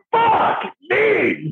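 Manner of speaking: high, hammed-up exasperated groan; mock exasperation. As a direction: react like your whole plan just collapsed. Told no serious questions.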